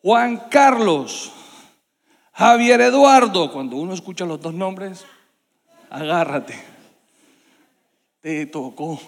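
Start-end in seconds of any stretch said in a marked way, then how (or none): 0.0-3.5 loud, drawn-out call of both names; stern dad yelling up from the patio; father's shout. Retold or imitated. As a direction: stretch each name slowly, louder and higher. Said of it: "y gritó".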